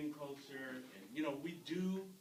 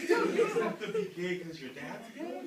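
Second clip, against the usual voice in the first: loud and higher, laughing through it; 8 semitones higher, 9 dB louder than usual. Retold or imitated. Dad laughing as he reassures his son. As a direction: laugh while reassuring him. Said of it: "So I started laughing".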